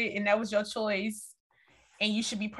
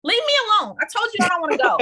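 loud, high, fed-up shout; angry yell; 8 semitones higher, 6 dB louder, much faster than usual. Retold or imitated. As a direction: snap at him to leave. Imagined guy told off harshly.

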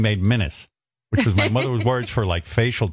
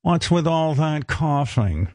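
higher, slow, drawn-out nagging; scolding mother; 4 semitones higher, slower than usual. Retold or imitated. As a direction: imitate an exasperated mother, stretching the words. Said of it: "my mother looked at me and she goes".

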